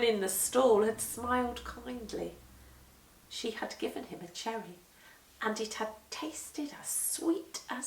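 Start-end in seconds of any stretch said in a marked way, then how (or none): none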